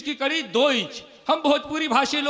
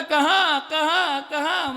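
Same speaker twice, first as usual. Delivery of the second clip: put-on a touch of a baby's wail, kahan kahan; mock baby crying kahan; 3 semitones higher, much slower than usual. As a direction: newborn asking where am I, a hint of a cry. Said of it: "कहता है".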